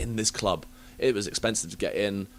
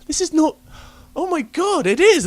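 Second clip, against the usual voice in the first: climbs higher and louder as he is recognised; realising the stranger is a famous DJ, rising excitement; starstruck disbelief; 5 dB louder than usual. Retold or imitated. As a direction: gasp it out, star-struck. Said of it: "I'm like".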